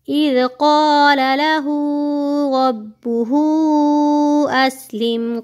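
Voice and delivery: slow chanted recitation, heavy qaf; stretched tajweed chant; Quranic recitation. Shown as a demonstration. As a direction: recite slowly and melodically. Recites full verse.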